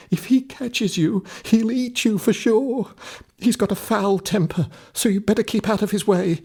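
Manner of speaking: put-on fearful old woman. Old woman warning Jack about the giant's temper. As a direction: scare him into hiding.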